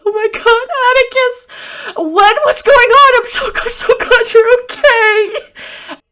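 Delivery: very high, loud tearful wail; hammed-up sobs of relief, squealed at full volume; overcome, crying out in a high squeaky voice; mock sobbing. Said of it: "sobbing and gives her a hug".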